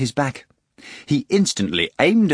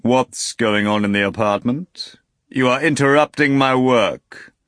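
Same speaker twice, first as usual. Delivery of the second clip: low, heavy voice; 3 semitones higher, 4 dB louder than usual. A narrator's reading. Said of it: "a low, heavy voice".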